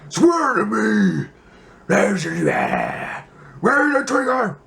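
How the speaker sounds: hugely loud, high, strained growl; raspy Bale Batman. Retold or imitated. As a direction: rasp and roar it like a gravel-throated Batman. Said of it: "the guy with throat cancer over there".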